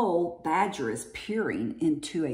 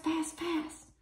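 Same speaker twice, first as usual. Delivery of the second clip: softer and softer, trailing away; fading whisper; 9 semitones higher, 8 dB quieter than usual. A narrator's reading. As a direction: let the words zip off into the distance. Something speeding past.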